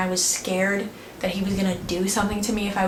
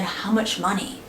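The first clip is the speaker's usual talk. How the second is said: usual speaking voice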